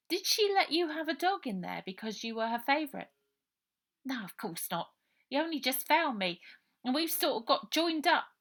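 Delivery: put-on a girl's teasing question and a boy's huffy reply; children talking. Ida teasing Robert about the dog, high children's voices.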